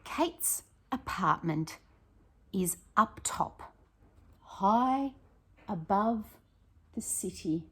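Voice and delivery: slow, lilting read-aloud voice; storybook reading. A narrator's reading. Teacher reading a story to her pupils, slow and lilting. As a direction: read it slowly, like a picture book.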